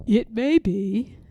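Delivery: slow, lightly voiced bear; a slow, thoughtful Pooh. A narrator's reading. Pooh answers Piglet, slow and ponderous.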